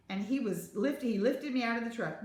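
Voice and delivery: ordinary delivery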